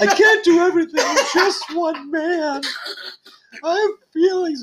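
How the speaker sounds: high, laughing whiny martyr; mock self-pity. Husband mocking his own complaints, high laughing whine. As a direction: ham up the overworked husband.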